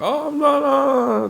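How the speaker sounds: Al Pacino grandfather. Retold or imitated. Imitating the grandfather character in the movie, high mock wail. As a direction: raise the pitch high and hold it, imitating an old man. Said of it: "feels like a fucking grandfather".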